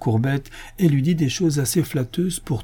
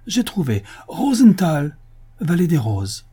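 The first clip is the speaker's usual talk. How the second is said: eureka; 8 semitones higher, 4 dB louder than usual. A narrator's reading. Le commissaire trouve le nom.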